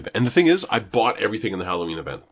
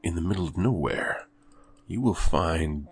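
movie trailer voice; 4 semitones lower, 4 dB quieter than usual. Pretend. Reading a horror game blurb.

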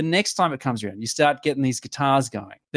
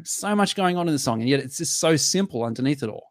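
usual speaking voice

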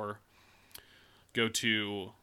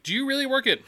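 high mock clerk voice; 14 semitones higher, 6 dB louder, faster than usual. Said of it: "She's like".

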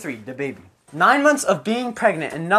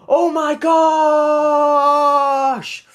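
high, drawn-out cry of shock; shocked exclamation; 7 semitones higher, 4 dB louder, much slower than usual. A narrator's reading. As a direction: act out a horrified discovery, stretching the last word. Cop in story discovers a body.

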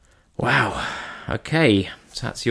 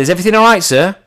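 shopkeeper; 8 semitones higher, 4 dB louder, faster than usual. Retold or imitated. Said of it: "The shopkeeper says".

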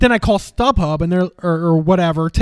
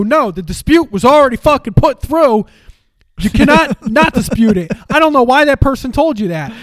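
mock irate agent; 5 semitones higher, 4 dB louder than usual. Retold credit card agent scolding.

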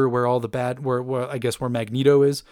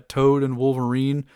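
usual speaking voice

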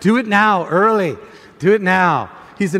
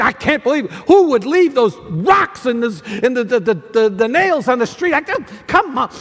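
exasperated rant; 5 semitones higher than usual. Acted. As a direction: rant at the nails in the street. Acted flat tire rant.

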